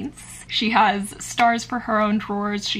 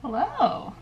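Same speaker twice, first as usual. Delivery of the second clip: ordinary talk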